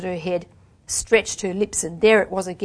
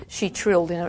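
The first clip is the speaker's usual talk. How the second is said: plain reading voice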